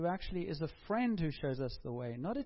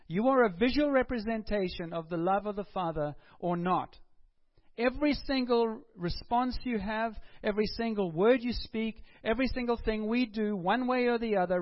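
raised, driving preacher voice, much louder; full-force preaching; 5 semitones higher, 7 dB louder than usual. Acted. Preacher urging the congregation to be authentic, loud and driving.

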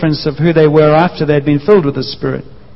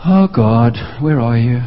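forlorn lament; 6 semitones lower, slower than usual. Acted. Disciple calling out to absent God.

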